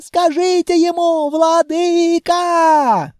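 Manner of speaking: high, loud panicked wailing; terrified screaming confession. A narrator's reading. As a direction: blurt it all out in terror. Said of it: "эльф завопил, как пароходная сирена".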